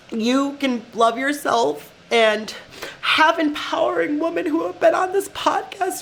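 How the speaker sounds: high, tearful and halting; crying. Real, not acted. Podcaster confessing she doesn't always feel strong, crying. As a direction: keep talking through real tears, voice pushed up. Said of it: "I'm, like, legit crying".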